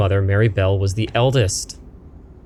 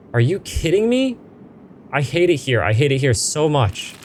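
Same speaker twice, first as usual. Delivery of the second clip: high, frantic disbelief; fed-up frightened cousin; exasperated outburst; 8 semitones higher than usual. Acted. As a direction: snap in disbelief then vent. Character fed up, panicking.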